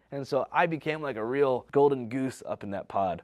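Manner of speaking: usual speaking voice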